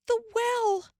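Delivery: high gasp of fear; sudden dread; frightened exclamation. A narrator's reading. Ma realizes Grace may be in the well, frightened cry. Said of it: "She exclaimed".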